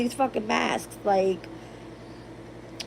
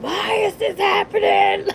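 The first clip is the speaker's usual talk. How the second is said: much higher, louder slow mock wail; mock crying wail; 13 semitones higher, 6 dB louder than usual. Woman reenacts crying to Spirit, much higher hammed-up wail. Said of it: "I would cry and be like".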